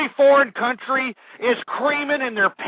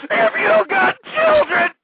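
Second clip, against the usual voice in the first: much higher, slower, voice climbing; rising outrage; slower than usual. Real, not acted. Host demanding parents take responsibility, voice climbing. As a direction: repeat it as if it stings. Rant builds.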